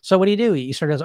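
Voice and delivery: ordinary delivery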